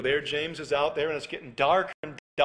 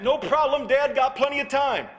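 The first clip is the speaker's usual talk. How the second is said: carefree brush-off; 9 semitones higher, 5 dB louder than usual. Retold or imitated. Son brushes dad off.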